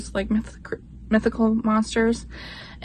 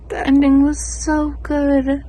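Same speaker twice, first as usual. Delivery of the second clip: excited gush; 5 semitones higher, 6 dB louder than usual. Real, not acted. Louder gush about the book.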